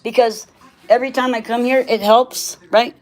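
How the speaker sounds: ordinary talk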